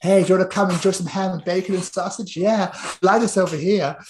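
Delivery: high-pitched absurd pig character; silly high voice for a flying pig; talking advert pig. Acted. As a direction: pitch it up as a pig making an offer. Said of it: "a pig turns up out of nowhere".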